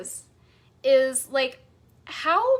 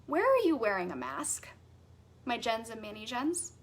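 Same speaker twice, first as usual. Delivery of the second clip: ordinary talk, nothing marked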